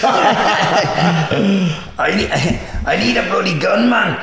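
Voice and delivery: a hammed-up Glasgow retry that breaks into laughter; Glasgow accent. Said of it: "That's a shit Glasgow accent".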